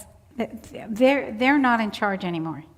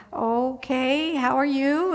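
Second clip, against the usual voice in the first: higher, drawn-out okay; hesitant, humoring reply; 4 semitones higher, slower than usual. Retold or imitated. Visitor answering Eddie's strange yell, hesitant and humoring. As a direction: answer gingerly, voice lifting.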